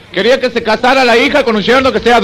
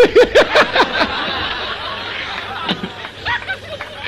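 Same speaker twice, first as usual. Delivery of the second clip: high burst of helpless laughter; real laughter; 13 semitones higher, 7 dB quieter than usual. Real, not acted. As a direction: crack up laughing at his own joke. Teller cracks up mid-punchline.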